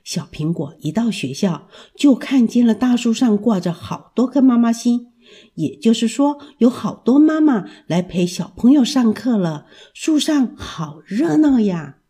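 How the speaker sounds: usual speaking voice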